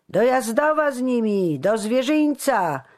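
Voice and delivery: high, loud mocking shout; loud, scornful heckling; jeering. A narrator's reading. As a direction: jeer at the prisoners from the crowd. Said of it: "szydził weselszy".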